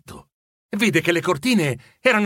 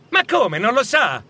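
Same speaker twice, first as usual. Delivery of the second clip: put-on boy's higher-pitched, puzzled reply; surprised; 7 semitones higher, 3 dB louder than usual. Young man replying to Scrooge at the window, surprised. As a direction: answer in raised-pitch disbelief. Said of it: "replicò il giovane sorpreso".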